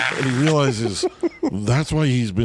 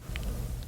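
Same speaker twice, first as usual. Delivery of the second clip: quiet breathy gasp of realisation; soft gasp; 15 dB quieter than usual. Retold or imitated. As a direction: gasp as it clicks. Imitating Finney's realisation.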